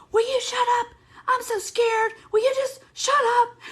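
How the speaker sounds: high squeaky panicked Elf voice; Will Ferrell impression. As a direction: imitate the Elf guy yelling to shut up. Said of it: "Will Ferrell ... Elf guy. And he was like".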